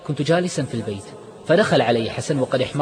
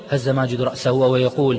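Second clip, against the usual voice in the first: ordinary delivery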